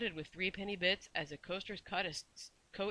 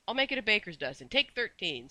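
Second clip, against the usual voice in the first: loud, higher, generous offer; cheerful; 4 semitones higher, 7 dB louder than usual. A narrator's reading. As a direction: offer it with bouncy good cheer. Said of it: "said Missus Corey cheerfully".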